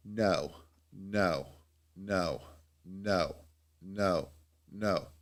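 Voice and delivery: soft, evenly spaced refusals; retold rejections. People refusing a quote on the phone, quiet repeated refusals. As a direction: imitate one quiet turn-down after another.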